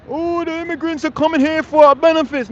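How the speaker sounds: high, loud whiny imitation of a complainer; mock outrage. Speaker mocking anti-immigrant critics with hammed-up outrage. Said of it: "fake outrage about".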